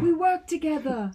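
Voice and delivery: loud, high, drawn-out movie quote; imitating Thor. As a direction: belt it out slowly in a put-on voice. Quoting Thor's line from the film.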